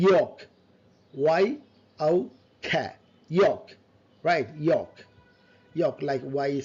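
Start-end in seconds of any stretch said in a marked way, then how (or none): none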